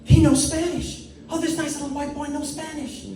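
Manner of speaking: put-on high, delighted old lady voice; excited old Spanish lady. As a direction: gush like a thrilled grandma. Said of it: "because they go".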